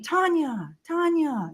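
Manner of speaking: high, drawn-out name call. Retold or imitated. Friend searching for a missing companion, imitated high sing-out call. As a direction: imitate a friend calling from a distance.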